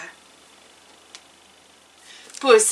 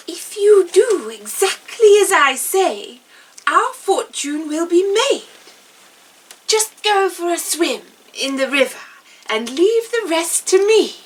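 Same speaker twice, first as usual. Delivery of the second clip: higher, eager cat voice; scheming cat; 7 semitones higher than usual. Acted. The cat tells his master the plan, higher and eager. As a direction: let him in on a clever plan.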